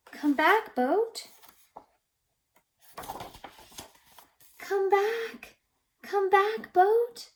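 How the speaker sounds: high, loud call after a boat floating away. Pretend. To the runaway paper boat, a high loud call. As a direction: shout come back to the boat.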